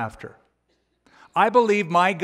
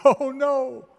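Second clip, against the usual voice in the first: despairing oh no; 8 semitones higher than usual. Retold or imitated. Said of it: "an exclamation word of disappointment, despair".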